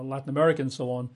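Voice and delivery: usual speaking voice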